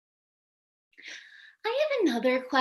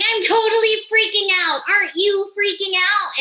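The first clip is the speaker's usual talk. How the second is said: freaking out; 9 semitones higher, 8 dB louder than usual. Retold or imitated. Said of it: "I was like".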